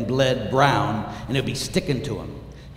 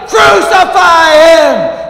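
high, drawn-out full shout; roaring, long-held demand; crowd cry; 16 dB louder, much slower than usual. Acted. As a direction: scream it with the mob. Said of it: "we're in the crowd and we cry".

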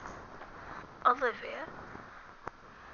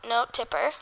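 normal talking voice

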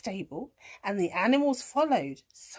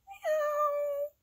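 very high, drawn-out mock meow; cat meow. A narrator's reading. Cat hopping onto the gate, high long meow. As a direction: mew like a cat. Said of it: "gate and meowed".

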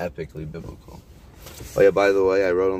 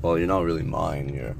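normal talking voice

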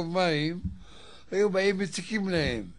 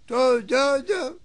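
high, loud cry of good; retold shout; 9 semitones higher, 6 dB louder than usual. Minister suddenly yelling before the queen, loud cry. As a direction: burst out yelling mid-speech. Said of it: "והתחיל לצעוק".

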